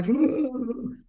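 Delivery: high-pitched demonstration wail; put-on high crying noise; mock ugly cry. Shown as a demonstration. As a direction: fake a high, wordless crying sound. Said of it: "You can't have an ugly cry".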